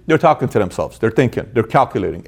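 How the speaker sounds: normal talking voice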